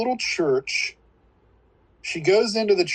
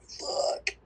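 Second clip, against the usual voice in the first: muttered curse; 9 dB quieter than usual. Retold or imitated. Gregory storming out.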